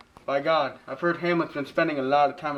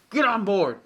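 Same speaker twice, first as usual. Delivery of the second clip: ordinary delivery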